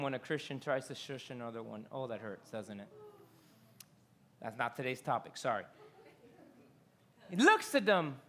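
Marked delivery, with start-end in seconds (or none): 0.0-5.6 hushed aside. Real, not acted. Aside, drops voice.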